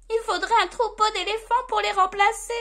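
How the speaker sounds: high, loud, rapid elf voice; dismayed elf. A narrator's reading. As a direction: rattle it out in a little elf's panic.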